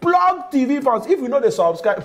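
high, slow hype intro; high announcer call. Shown as a demonstration. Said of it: "show intro catchphrase".

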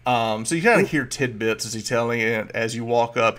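ordinary talk, nothing marked

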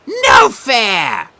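loud whiny wail. Acted. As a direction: wail like a cheated child. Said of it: "the Sphinx wailed".

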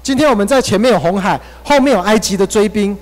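high, loud, frantic protest; panicked complaint. Retold or imitated. Israelites panicking at Moses by the Red Sea, high and loud. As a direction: wail at Moses as if trapped by the sea. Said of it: "他们非常的惊慌，非常害怕".

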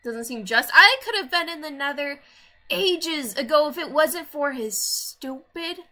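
mock outrage. Real, not acted. Ranting about hard challenge.

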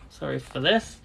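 ordinary talk

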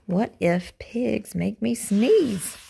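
ordinary delivery

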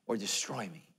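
hushed whisper of warning. Real, not acted. Pastor warning the congregation, whispered. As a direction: drop to a whisper on the consequence. Warning about what you worship.

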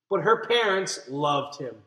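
ordinary delivery

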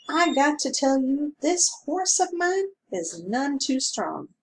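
a hint of a grumbling country constable; small-town constable complaining mildly; gruff constable. A narrator's reading. Constable voiced in read story.

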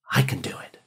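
a low breathy whisper to yourself; whispered affirmation. Shown as a demonstration. Student whispering self-belief to himself before a test, hushed whisper. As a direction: breathe it like a private promise.

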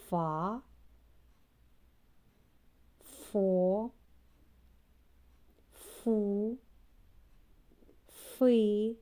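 each syllable said alone and slowly, with long pauses between; syllables read aloud. Shown as a demonstration. Teacher leading little kids in reading ф syllables, slow and distinct. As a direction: spell out every ф syllable carefully for children to repeat. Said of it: "Прочитаємо разом склади з літерою".

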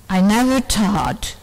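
ordinary talk

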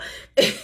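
a short, voiceless burst of laughter; breathy laugh. Real, not acted. Cracking up at the young man's story, voiceless laugh.

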